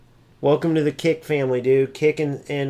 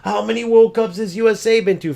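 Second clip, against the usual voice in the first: higher-pitched imitation of a rival; mocking quote; 6 semitones higher than usual. Streamer quoting his rival's challenge in a mocking higher voice. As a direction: mimic a challenger's taunting question, pitched up. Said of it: "Then he goes".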